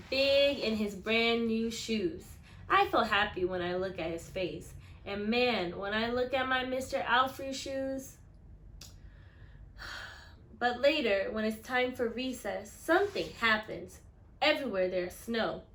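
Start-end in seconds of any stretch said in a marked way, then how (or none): none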